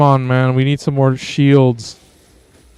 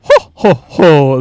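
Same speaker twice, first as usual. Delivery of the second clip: Simet Liket's laugh; 6 dB louder, slower than usual. Pretend. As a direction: act out a character's spaced-out laugh. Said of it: "That's how he laughs".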